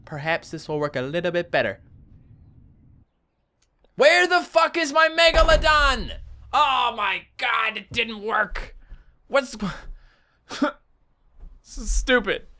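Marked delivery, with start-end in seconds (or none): 3.9-12.5 frustrated shout. Real, not acted. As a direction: yell at the broken game. Game failed again, angry outburst.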